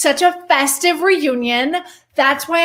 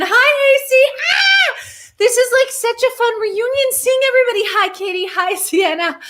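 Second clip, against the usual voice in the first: excited greeting; 10 semitones higher than usual. Real, not acted. Greeting friends joining the live.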